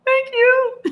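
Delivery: very high, bright, tiny thanks; high squeaky thank you. Retold or imitated. To her viewers, a high squeaky thank-you. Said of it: "I'm like".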